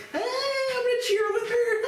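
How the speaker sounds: screeched very high and loud, slow; squealing cheerleader cheer; shrieking cheerleader. Retold or imitated. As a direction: yell it like a hyped-up cheerleader. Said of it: "high school cheerleader".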